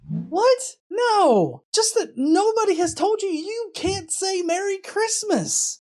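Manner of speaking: normal talking voice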